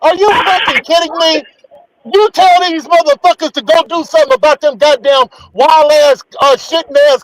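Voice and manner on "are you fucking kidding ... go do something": high, incredulous outburst; exasperated rant. Real, not acted. [name] ranting about HOA rules, high and incredulous. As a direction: throw it up in disbelief.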